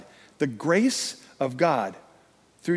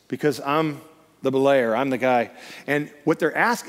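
normal talking voice

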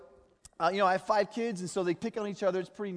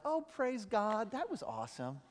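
hammed-up positivity after being fired; mock cheerful; 5 semitones higher, 6 dB quieter than usual. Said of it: "you're like".

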